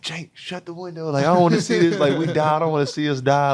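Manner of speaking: high, frantic plea to shut the window; panicked plea. Retold or imitated. Player mimics teammate begging Jake to close the window, high panicked voice. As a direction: squeal it out, scared to look.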